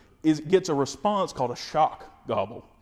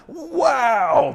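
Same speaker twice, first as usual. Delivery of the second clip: a much higher, loud thrilled wow; excited turkey; 12 semitones higher, 7 dB louder than usual. Retold or imitated. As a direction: burst out like a bird that just got pumped up.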